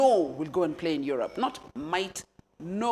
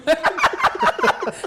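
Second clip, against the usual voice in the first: high laughter; 7 dB louder than usual. Real, not acted. Laughing at her private school.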